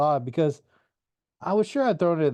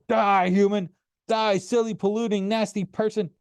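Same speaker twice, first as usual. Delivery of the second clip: gleeful game rage; 8 semitones higher than usual. A narrator's reading. Grover lost in shooting game.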